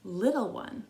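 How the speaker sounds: gentle read-aloud picture book voice, long pauses between verses; reading to children. Acted.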